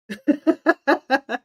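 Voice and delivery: high, loud burst of laughter. Real, not acted. Amused by her own remark.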